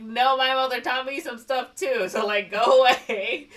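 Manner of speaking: high, loud comic rebuff; mock firm send-off. She sends her suitor away at the door, mock firm send-off. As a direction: shoo him away, loud and high, a laugh breaking in.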